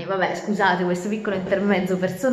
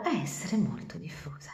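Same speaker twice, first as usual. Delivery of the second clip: whispered aside; 2 semitones lower, 8 dB quieter than usual. A narrator's reading. Aside in story reading.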